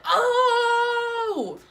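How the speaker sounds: high stretched shouted Oh; crowd yell. Retold or imitated. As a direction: hold a long shocked crowd cry. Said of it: "We were like".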